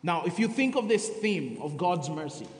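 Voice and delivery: ordinary talk, nothing marked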